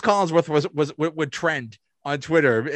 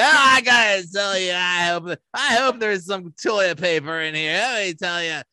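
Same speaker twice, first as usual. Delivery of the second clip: mock play-by-play commentator, higher and animated; Chris Collinsworth; 4 semitones higher, 4 dB louder than usual. Host imagines commentator in porta potty, play-by-play impression. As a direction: parody an announcer narrating every moment. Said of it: "if he does play by play".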